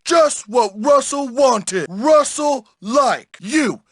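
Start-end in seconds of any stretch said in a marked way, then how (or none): none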